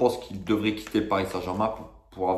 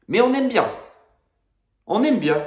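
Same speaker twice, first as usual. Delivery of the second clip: much higher, louder sarcastic jab; sarcastic high mockery; 13 semitones higher, 5 dB louder than usual. Real, not acted. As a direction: say it with mocking irony. Ironie sarcastique sur le club. (ironie moqueuse et aiguë)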